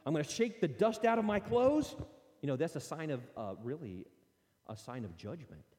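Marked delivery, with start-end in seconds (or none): 0.1-1.9 higher and louder, done with them; fed-up exit. Retold or imitated. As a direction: announce the walkout with force. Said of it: "He says".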